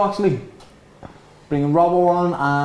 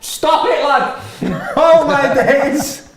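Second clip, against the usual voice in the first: excited laughing; 9 semitones higher, 5 dB louder, much slower than usual. Real, not acted. Laughing at Thiago's trick.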